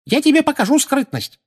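put-on very high old woman's shriek; high-pitched furious retort; angry scream. A narrator's reading. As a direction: snap back in a high scream. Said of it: "закричала миссис Фиг".

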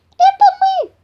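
very high, loud mouse squeal; shrieking tiny mouse trying to be heard; squeaky shout. A narrator's reading. Climbing mouse repeating greeting to papa bear, shouting. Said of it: "закричал лазающий мышонок".

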